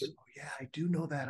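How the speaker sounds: soft whisper, almost to oneself; a quiet, private admission; whispered realization. Retold or imitated. A client admitting it to the coach, whispered.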